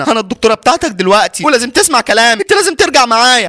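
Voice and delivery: high-pitched, pressing demand; insist, pitch climbing as you go; urgent insistence. Acted. Doctor urging runaway patient back to hospital, insistent.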